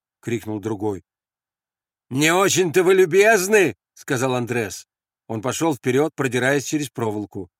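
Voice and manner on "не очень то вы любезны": high, loud complaint; loud retort. Acted. Andres reproaching the hostile sentries, loud retort. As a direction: throw it back at them.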